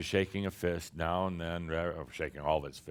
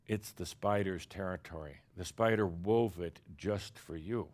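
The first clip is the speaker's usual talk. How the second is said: ordinary talk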